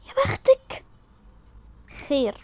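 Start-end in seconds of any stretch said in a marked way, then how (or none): 0.1-0.8 gasped, high, slow and breathy; airy, lovestruck envy; envious swoon. Acted. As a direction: gasp it with longing envy. Envies girl living opposite him.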